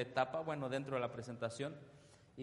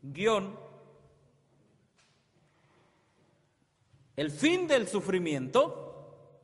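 full-force preaching; 8 semitones higher, 10 dB louder than usual. Acted. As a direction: preach it out to the congregation, stretching each word. Preacher proclaiming again. (predicación a toda voz)

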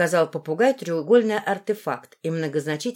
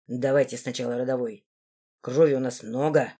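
low, understated smirk; 8 semitones lower than usual. A narrator's reading. Руфус to the magister about blood, smirking. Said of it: "усмехнулся Руфус".

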